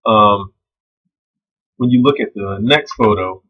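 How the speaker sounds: ordinary talk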